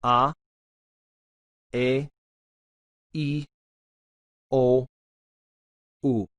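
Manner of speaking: each vowel said slowly and clearly apart; A, E, I, O, U in crisp Spanish sounds. Shown as a demonstration. Teacher sounding out each Spanish vowel. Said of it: "pronounce properly".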